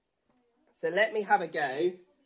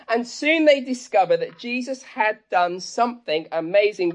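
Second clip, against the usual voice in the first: normal talking voice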